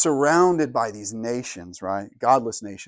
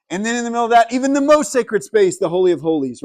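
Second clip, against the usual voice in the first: building excitement; 10 semitones higher, 6 dB louder, faster than usual. Real, not acted. Building to the Holy of Holies.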